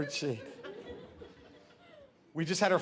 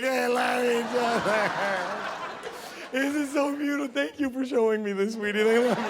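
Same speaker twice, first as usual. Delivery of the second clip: mock crying; 3 semitones higher than usual. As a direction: blubber it as if overcome by a romance film. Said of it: "I'm crying".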